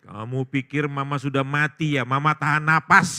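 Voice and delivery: loud acted mother telling off daughter; booming mock-outraged mother; indignant scolding. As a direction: scold at full volume. Revived mother scolds her daughter.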